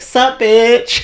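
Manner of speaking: high, sassy mock greeting; bright cheeky hello to a stranger. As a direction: toss it off like a cheeky friend. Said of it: "got her and was like".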